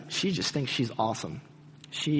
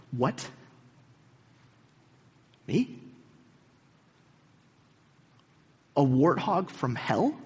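stunned disbelief; slower than usual. Retold or imitated. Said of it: "Missus Turpin is struck".

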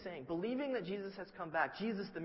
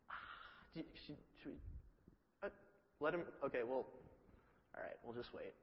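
breathy hushed dithering; unsure whether to stop him, trailing off; nervous hushed hesitation; 3 semitones lower, 10 dB quieter, faster than usual. Retold or imitated. Timid disciples whispering whether to stop Peter.

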